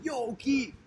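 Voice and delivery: a light, high-pitched hail; calling out a friend. Retold or imitated. Henry walks up to the teacher Keith: high, casual call. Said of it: "Henry comes up to me".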